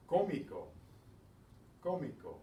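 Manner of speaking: Spanish CÓ-mi-co with the accent up front; stress on accented syllable. Shown as a demonstration. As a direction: punch the first syllable of Cómico. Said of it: "put the stress on that".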